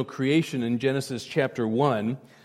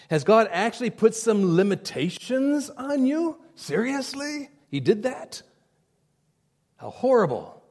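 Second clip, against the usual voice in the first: mock incredulous Satan; 2 semitones higher than usual. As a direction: fake shock at God's limits.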